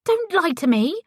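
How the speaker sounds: high, sharp warning; angry demand. A narrator's reading. Ellie warning Paisley not to lie, angry demand. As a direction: snap it out in anger.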